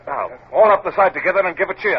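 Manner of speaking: ordinary talk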